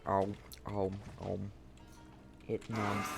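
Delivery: ordinary talk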